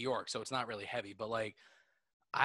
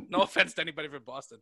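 laughing; 4 semitones higher, 7 dB louder, faster than usual. Real, not acted. Laughing apology.

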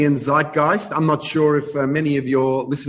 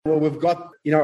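ordinary talk

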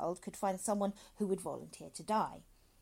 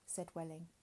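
usual speaking voice

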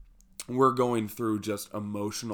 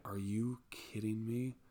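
stunned disbelief; 9 dB quieter than usual. Retold or imitated. Hearing the fatal story.

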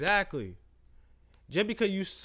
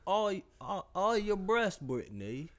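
slow, soft mock British host; British accent; 5 dB quieter, much slower than usual. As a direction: lean in with a smarmy British interviewer. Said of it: "your U K voice".

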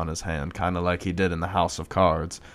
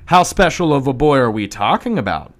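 higher, louder eager question; eager voiced character; 6 semitones higher, 7 dB louder than usual. Celebrity eagerly asking the men about the boy, higher eager voice. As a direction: ask it hungry and eager.

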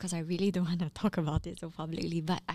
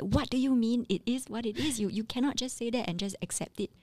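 mock exasperation, pitched up; annoyed partner; 5 semitones higher than usual. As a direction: imitate a partner protesting in annoyance. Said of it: "my partner gets so annoyed with me".

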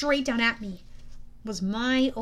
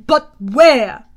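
much louder, forceful demand; booming; 15 dB louder than usual. A narrator's reading. General demanding the location from his underling, booming. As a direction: boom the question across the hall. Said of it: "boomed the man".

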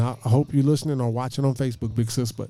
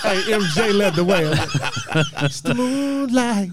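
high, loud, laughing through the joke; cracking up at his own line; laughing hard, slow on the moonlight; 8 semitones higher, 6 dB louder, slower than usual. Real, not acted. Joking [name] led him.

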